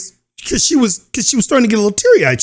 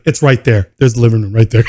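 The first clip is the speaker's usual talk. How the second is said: mocking the girl; 10 semitones lower, 4 dB louder than usual. Commentator mocks girl pointing to living room, laughing. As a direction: lower and louder mocking imitation, laughing. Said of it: "the girl said".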